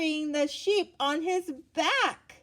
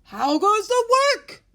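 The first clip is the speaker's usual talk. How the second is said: high, loud lazy farmer call; 11 semitones higher, 11 dB louder than usual. A narrator's reading. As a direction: holler it from bed, bossy. Farmer calls from bed.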